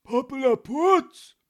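slow, high, very loud roar; irate little policeman roaring; furious bellow. A narrator's reading. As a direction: drown the protest with one angry word. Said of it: "brüllte der kleine Polizist erbost".

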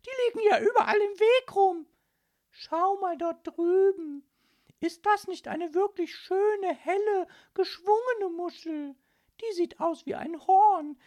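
very high, loud scolding lady voice; fussy mother. Acted. Mother telling her daughter to drop the mussel, high and loud. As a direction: fuss over the child shrilly. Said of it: "rief Frau Linkmann".

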